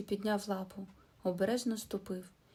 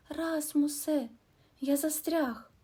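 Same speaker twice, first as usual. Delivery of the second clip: high, louder, slow cry to a friend; calling for help; 7 semitones higher, 4 dB louder, slower than usual. A narrator's reading. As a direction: call out stuck in the mud. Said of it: "А тоді закричав".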